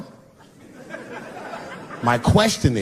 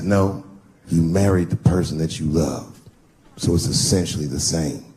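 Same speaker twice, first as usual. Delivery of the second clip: friend voiced much lower; deep friend's voice; 10 semitones lower than usual. Friend gently correcting Dave, voiced much lower. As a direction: correct him in a low calm voice. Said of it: "He said".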